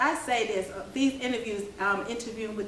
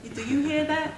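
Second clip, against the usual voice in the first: laughing while speaking; chuckling through the words; 7 semitones higher, 3 dB louder than usual. Real, not acted. Laughing at own accent bit.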